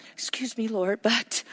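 timid near-whisper; acted meek, hushed interruption; Moses. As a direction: beg pardon very softly and hesitantly. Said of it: "He was very timid and insecure".

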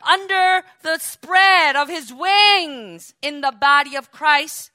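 preacher rousing the congregation, full force; preaching at full force. Acted. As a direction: lift the room, build to hallelujah. Preaching climax with hallelujah.

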